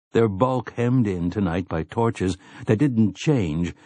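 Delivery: plain reading voice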